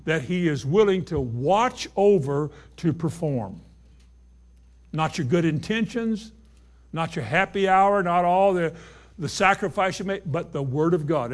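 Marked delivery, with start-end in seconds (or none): none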